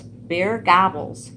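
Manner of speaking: ordinary delivery, nothing marked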